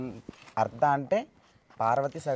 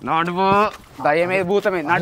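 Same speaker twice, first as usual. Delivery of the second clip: raised, loud and impatient push onward; loud, higher-pitched dismissal of the danger; loud urging; 5 semitones higher, 10 dB louder than usual. Real, not acted. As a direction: shout them forward past their fear. Urging scared companion onward, arguing.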